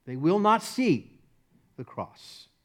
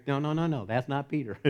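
normal talking voice

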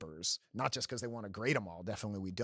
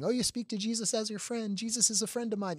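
high airy mock new-age voice, pitched way up; mock hippy dippy; 11 semitones higher than usual. Host mocking hippy-dippy Jesus talk, high sing-song parody. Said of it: "it can sound kinda like this new age hippy dippy thing".

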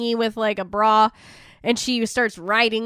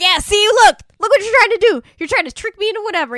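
much higher, louder accusing rant; mock accusation; 11 semitones higher, 8 dB louder, faster than usual. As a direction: accuse him, hammed-up. Daughter accusing Satan.

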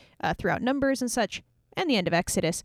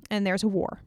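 ordinary talk

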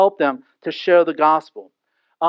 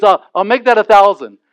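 hasty loud pledge; 2 semitones higher, 10 dB louder than usual. Retold or imitated. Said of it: "And he goes".

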